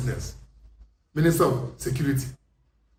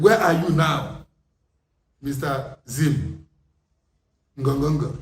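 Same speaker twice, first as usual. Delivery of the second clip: slow mocking taunt; 2 semitones lower, slower than usual. Taunting Zimbabweans.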